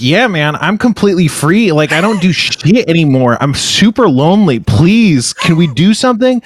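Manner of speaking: hammed-up lonely plea, overeager; desperate pleading. Host re-enacting his plea to hang out with his friend, desperately needy. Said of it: "that is kind of an off-putting energy".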